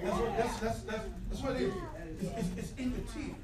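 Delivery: quiet preaching. Acted. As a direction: bring the church down to a hush about tears. Tender quiet sermon passage.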